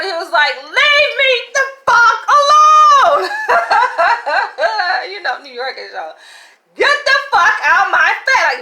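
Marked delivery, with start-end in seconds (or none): 0.6-3.3 high, slow, shouted curse; husband yelling at the stranger, a hammed-up furious shout; angry yell. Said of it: "And he yelled". 3.3-5.0 high, loud burst of laughter. Real, not acted. Laughing after the retold yell. 6.7-8.6 high, loud retold shout; husband yelling at the stranger, a loud mock shout; really loud. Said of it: "like, really loud".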